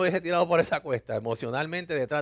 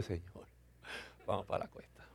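near-whispered plea to God; a whispered aside to God at the top of the hill; hushed prayer; 4 semitones lower, 13 dB quieter than usual. Retold or imitated. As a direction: breathe the prayer under your breath, nervous. Prays before the steep descent.

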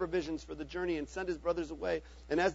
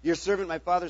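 plain reading voice

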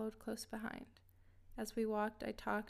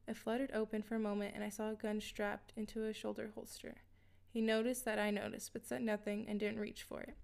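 plain reading voice

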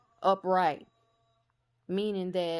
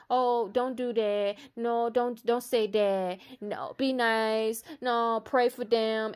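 higher, pleading goody-goody voice; a mocked do-gooder, higher and whiny; mocking her old self; 4 semitones higher, slower than usual. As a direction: mimic the preachy nice self, higher. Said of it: "I used to shun".

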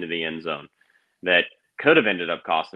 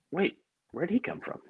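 higher, startled retold wonder; surprise; 10 semitones higher, 6 dB quieter than usual. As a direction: sound caught off guard, much higher. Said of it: "I was like".

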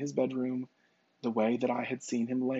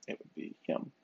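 breathy hushed whisper, softer; 9 dB quieter than usual. Retold or imitated. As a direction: lean in and whisper the answer. Hushed psychic naming the son.

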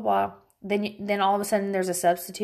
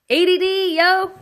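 loud, high joking exclamation; loud self-mocking; 10 semitones higher, 7 dB louder than usual. Real, not acted. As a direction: shout it at yourself. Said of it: "total brain fart".

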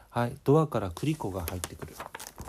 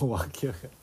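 ordinary delivery, nothing marked

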